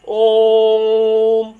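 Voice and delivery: ओम drawn out long and loud, the ओ held; prolonged Om. Shown as a demonstration. Teacher demonstrating ओम drawn out long for students. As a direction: stretch the ओम for a pluta vowel. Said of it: "इसका उच्चारण जब आप करेंगे तो कैसे बोलेंगे".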